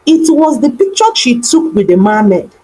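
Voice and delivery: ordinary delivery, nothing marked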